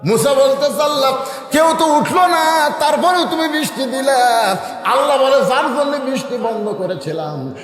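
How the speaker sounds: high, ringing questioning chant; full-force preaching. Acted. Musa asks God why rain came, high fervent preaching. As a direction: cry it out in astonishment to the gathering.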